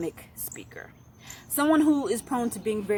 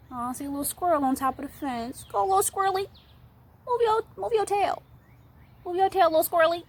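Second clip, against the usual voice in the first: very high, cutesy little voice; cooing to an animal; 8 semitones higher than usual. Real, not acted. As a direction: coo sweetly at a tiny squirrel. Cooing to a squirrel she spots.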